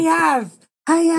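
high lisping karate yell; squeaky kid karate cry. Pretend. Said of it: "lisp in their hi-ya".

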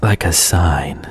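slow, breathy mystic whisper. Pretend. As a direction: whisper it like a revelation. Whispered echo.

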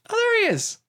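high-pitched cry of spotting someone; mock delighted recognition. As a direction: squeal it as if a star just appeared. Retold delight at spotting a famous actor.

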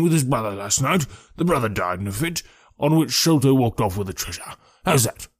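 wheezy, self-important policeman in full flow; blustering, wheezy police detective. Acted. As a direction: theorize aloud with pompous self-satisfaction.

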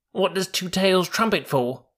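put-on high-pitched, questioning young mule; curious young mule. Young mule asking the bullocks about Two Tails, high and curious.

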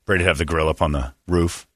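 ordinary delivery

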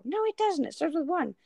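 put-on high, contradicting kid voice; imitating daughter. Mom replaying daughter's argument about zero, high mimicking voice. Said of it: "she's like".

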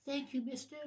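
put-on small, hushed voice of a grateful child; rescued kid. Rescued child thanking the adventurer, tiny soft voice. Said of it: "The kid looks and says".

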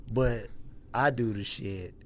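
ordinary delivery

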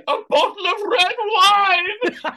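laughing through the words. Real, not acted. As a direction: burst into a laugh on wine. Biggins impression, laughing.

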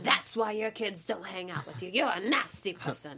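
a hammed-up evil Irish-sounding accent; villain voice in a leprechaun accent. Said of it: "That's gonna sound British".

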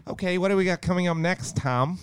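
put-on high-pitched try at an American accent; American accent attempt. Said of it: "I'll practice for the next minute".

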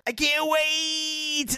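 high, very drawn-out mimicked catchphrase voice; imitated rant voice. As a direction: stretch it out high, imitating your co-host's nasal rant. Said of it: "the rant in that voice was the same voice".

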